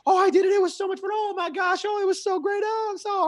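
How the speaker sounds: loud, very high-pitched gushing; excited presenter imitation. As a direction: gush like a presenter thrilled it's over, hammed-up. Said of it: "because of how excited they were".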